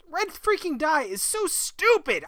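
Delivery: real annoyed yell; angry outburst. Real, not acted. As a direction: vent at the game's demands. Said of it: "I'm getting angry".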